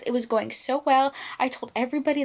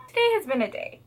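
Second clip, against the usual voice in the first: usual speaking voice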